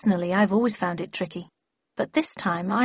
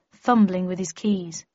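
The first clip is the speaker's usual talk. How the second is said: ordinary delivery, nothing marked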